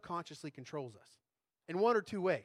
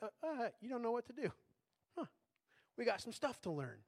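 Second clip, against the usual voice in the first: softer, kind and easygoing take; gentle self-talk; 5 semitones higher, 3 dB quieter than usual. Shown as a demonstration. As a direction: say it like a friend shrugging it off. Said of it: "Just change the voice of it".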